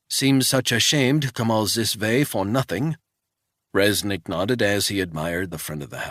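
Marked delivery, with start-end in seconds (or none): 0.1-3.0 put-on wary old housekeeper in a thick Swiss accent; old housekeeper questioning a stranger, thick Swiss accent. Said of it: "in a thick Swiss accent".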